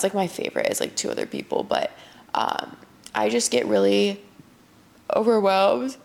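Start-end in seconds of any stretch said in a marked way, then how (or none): 2.3-6.0 yawning. Real, not acted. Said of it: "I keep yawning".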